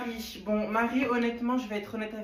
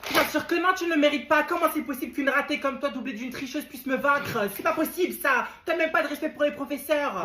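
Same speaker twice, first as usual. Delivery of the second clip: loud, high jealous rant; angry yelling; 5 semitones higher, 6 dB louder than usual. Acted. Jealous student yelling at her classmate in class, loud and high. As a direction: tear into the rival.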